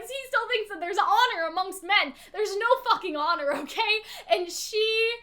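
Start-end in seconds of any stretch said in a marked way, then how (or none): none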